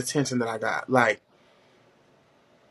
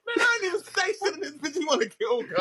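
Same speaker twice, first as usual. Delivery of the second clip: much higher exasperated outburst; retold telling-off; 4 dB quieter than usual. Student snaps at the teacher, high and exasperated. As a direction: snap back at the teacher, fed up.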